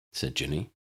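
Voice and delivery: usual speaking voice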